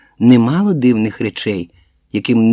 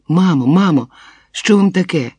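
raised, higher-pitched anxious call; crying out; 6 semitones higher, 4 dB louder than usual. A narrator's reading. Daughter runs upstairs to groaning mother, crying out. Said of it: "Кричу й біжу угору по сходах".

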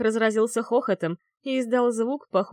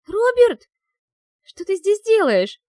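very high, loud squeak; squeaky voice; 12 semitones higher, 10 dB louder than usual. Acted. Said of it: "пропищала я писклявым голосом".

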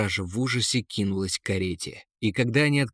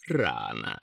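deep, soft sigh of a word; sighing eagle; 13 semitones lower, 5 dB quieter than usual. A narrator's reading. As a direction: let it drop low like a sigh. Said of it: "вздохнул Эрвин". (низко, со вздохом)

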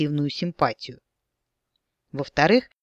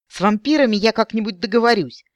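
shrill squealing; 6 semitones higher, 4 dB louder than usual. A narrator's reading. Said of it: "верещал Березаец".

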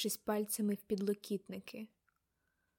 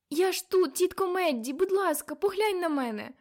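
loud, high, urgent appeal; desperate pleading; 8 semitones higher, 6 dB louder than usual. A narrator's reading. Girl shaking her entranced aunt, desperate pleading. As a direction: plead loudly to get through.

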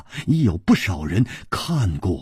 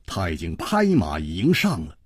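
plain reading voice

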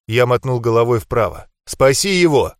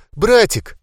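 Братик pitched even higher, breaking and falling; the second Братик goes higher and more frantic; higher desperate cry; 9 semitones higher, 4 dB louder than usual. A narrator's reading. Girl calling her brother again, more desperate cry. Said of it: "Толу сотрясали рыдания".

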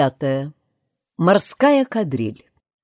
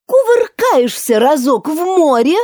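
high, loud excited exclaim; 10 semitones higher, 6 dB louder than usual. A narrator's reading. Mock Turtle exclaims while turning cartwheels. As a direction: burst out with glee. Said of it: "воскликнул Подкотик".

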